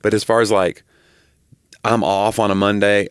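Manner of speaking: ordinary talk, nothing marked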